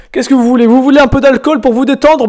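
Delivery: usual speaking voice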